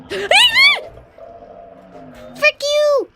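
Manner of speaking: high, loud startled yelp; startled shriek. Real, not acted. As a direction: shriek it out after a fright. Startled by jump scare.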